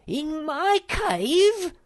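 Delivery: outraged that someone entered his cave; scream. A narrator's reading. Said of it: "screamed Sniff".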